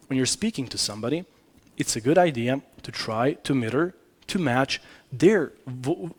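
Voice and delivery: usual speaking voice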